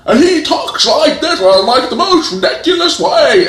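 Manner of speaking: loud, high, hammed-up Cockney accent; over-the-top bad Cockney. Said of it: "tries to do a Cockney accent".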